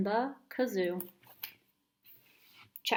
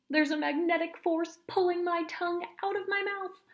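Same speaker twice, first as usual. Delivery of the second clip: higher, lightly hammed-up kid voice; dramatic kid; 9 semitones higher than usual. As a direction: play the magnetic force up a little. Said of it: "she said, licking".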